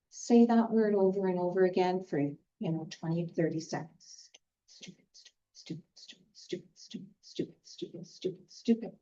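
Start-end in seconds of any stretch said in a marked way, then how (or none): 4.7-8.9 breathy whispered "stupid" repeated; whispered repetition to drain the word's sting; soft whisper. Shown as a demonstration. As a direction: murmur "stupid" over and over under your breath. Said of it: "You can just say it softly".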